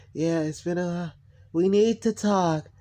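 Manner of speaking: drawn-out, robotically demonic mimicry; narcissist voice. Imitating a narcissist wanting to talk, robotic tone. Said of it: "the tone of the narcissist voice".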